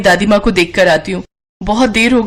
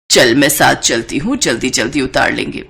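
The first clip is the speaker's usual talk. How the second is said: put-on a lower, older mother-in-law voice; 8 semitones lower than usual. Mother-in-law offers her daughter-in-law help with laundry, lower older voice.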